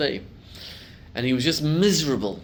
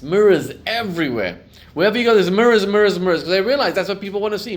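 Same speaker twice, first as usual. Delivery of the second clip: louder and higher with each mirrors; building preaching shout; 6 semitones higher, 10 dB louder, faster than usual. Real, not acted. Rabbi preaching to congregation, rising loud exclamation. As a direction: hammer the word home, rising.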